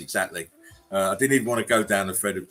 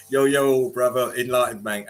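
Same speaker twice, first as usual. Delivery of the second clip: ordinary talk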